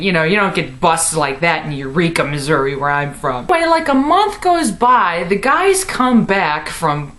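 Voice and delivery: ordinary delivery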